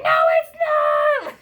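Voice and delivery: high, loud, slow shriek; hammed-up tantrum scream; shrieking denial. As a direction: yell it back at the nurses. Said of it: "And I'm like".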